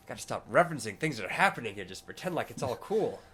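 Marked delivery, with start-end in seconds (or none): none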